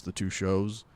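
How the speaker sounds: usual speaking voice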